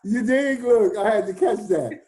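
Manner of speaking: ordinary talk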